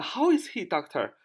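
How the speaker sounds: high opening, falling away on "doctor"; hospital visitor asking. Acted. Visitor asking the doctor how he is, high-pitched query. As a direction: put the question to a doctor. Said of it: "这是我们常常去医院问的啊".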